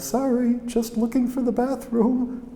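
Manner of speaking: high, meek apologetic voice; Pope Paul the sixth. Retold or imitated. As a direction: imitate a sheepish intruder, pitched well up. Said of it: "And the voice on the other side".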